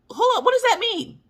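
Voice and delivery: high put-on voice of a baffled husband; mock confused husband. High mock husband voice, asking what the ancestors mean. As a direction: squeak it out like a confused man.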